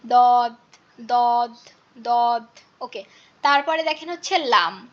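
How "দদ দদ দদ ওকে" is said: heavy dad sound from the tongue's back edge; Arabic letter dad. Shown as a demonstration. Teacher drilling দদ to the class, slow and deliberate. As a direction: press the tongue edge to the upper gums for দদ. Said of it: "দোয়াতের উচ্চারণটা হচ্ছে দদ".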